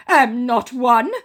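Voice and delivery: high, loud, slow put-on scammer voice; mock scammer voice. Scammer denying he's a scammer, high mock voice. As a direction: deny it indignantly. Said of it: "he goes".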